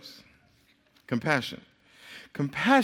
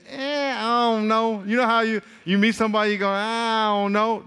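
hesitant shrug; 5 semitones higher than usual. Retold or imitated. Imitating unsure dater.

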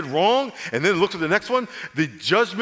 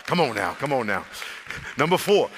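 ordinary talk

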